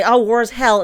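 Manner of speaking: sarcastic parroting of a cheap excuse; mock excuse. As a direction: scorn the speaker as you repeat it. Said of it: "You don't get to say".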